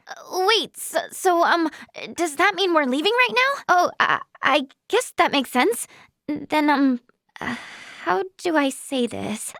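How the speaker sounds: halting, stammering uncertainty; unsure, with ums and false starts; flustered hesitation. Acted. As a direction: stumble as you search for words. Flustered, searching for words.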